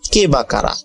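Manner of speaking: ordinary delivery, nothing marked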